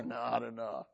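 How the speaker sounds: low, muttered "Not enough" said softly under the breath; quiet order. Retold or imitated. Elijah telling the men pouring water, quietly: not enough. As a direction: hold back, just murmur "Not enough".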